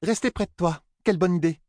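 happily agree, beaming; delighted. A narrator's reading. Fail delighted.

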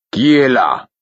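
high, loud called-out challenge; loud suspicious call; shout. A narrator's reading. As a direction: shout through a closed door. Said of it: "cria Burick".

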